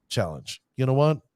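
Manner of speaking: ordinary talk, nothing marked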